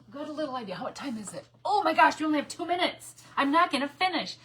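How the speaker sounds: usual speaking voice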